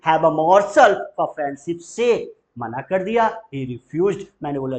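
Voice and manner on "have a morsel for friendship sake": very slow and louder, morsel stressed; slow loud drill; very slow model. Shown as a demonstration. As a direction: belt it out word by word. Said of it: "दोस्ती के खातिर एक कौर खा ले भाई".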